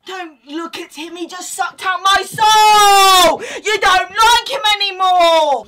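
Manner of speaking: high, loud mock-crying shouts at a Dementor toy; hammed-up fight. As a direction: play a kid wailing in a toy brawl.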